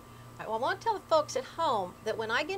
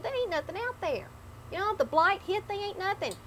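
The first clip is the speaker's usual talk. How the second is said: put-on old man voice; 10 semitones higher than usual. Old man answers wife.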